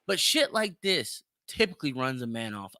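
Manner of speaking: usual speaking voice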